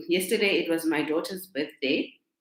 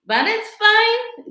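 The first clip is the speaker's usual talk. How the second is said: breezy shrug-off; 8 dB louder, slower than usual. Real, not acted. Shrugging off people who skip affirmations.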